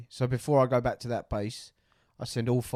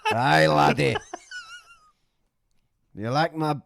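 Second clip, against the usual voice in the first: loud, high Scottish voice, laughing; hammy Scottish laddie, drawn out; Scottish accent; 6 semitones higher, 10 dB louder, much slower than usual. Pretend. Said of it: "is gonna be Scottish".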